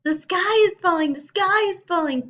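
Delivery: high, loud frantic shriek; panicked scream. Acted. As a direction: shriek it while running in terror. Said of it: "Chicken Little screamed as he ran".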